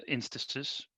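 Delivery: ordinary delivery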